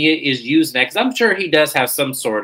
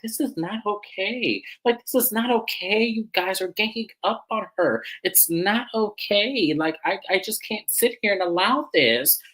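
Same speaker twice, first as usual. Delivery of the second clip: higher-pitched, drawn-out mock protest; imitating Stacey; 8 semitones higher than usual. Co-star objecting to the group ganging up, imitated in a higher voice. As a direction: retell her objection in a raised voice, stretching the words. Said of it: "Stacey was like".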